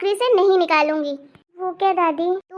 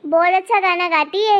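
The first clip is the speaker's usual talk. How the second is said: normal talking voice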